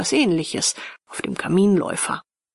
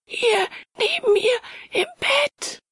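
very high, terrified falsetto; piercing, horrified cry; shrill crying out; slower than usual. A narrator's reading. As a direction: shriek it in broken pieces, pitched right up. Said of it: "schrie er schrill auf".

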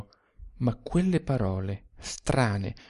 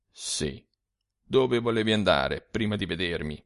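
lower, softer confiding voice of a dead man; a weary ghost sharing bitter secrets; ghostly old man; 6 semitones lower, 4 dB quieter than usual. A narrator's reading. Dead Sturzi confides to the young man, low and soft. Sturzi knows his plans.

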